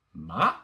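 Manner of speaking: MA starting low and rising sharply; rising MA, like climbing a hill; second tone. Shown as a demonstration. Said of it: "Let's review one more time".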